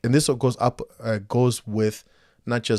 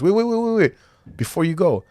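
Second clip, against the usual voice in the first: higher, urgent repeated waits; urgently begging the stranger to stay; urgent pleading; 9 semitones higher, 4 dB louder than usual. Retold or imitated. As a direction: call him back before he leaves.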